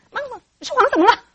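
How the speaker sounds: high, loud, indignant comeback; defensive mother. Acted. Mother caught lying saves face, loud defensive. 这时候我们脸面过不去了.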